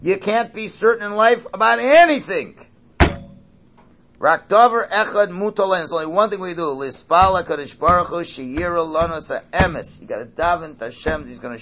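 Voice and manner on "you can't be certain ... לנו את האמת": fast, loud recitation of the Hebrew saying; quoting Hebrew text. Retold or imitated. Rabbi recites the Hebrew teaching to the congregation, fast and loud. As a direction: rattle the quote off quickly and loudly. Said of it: "he says it again".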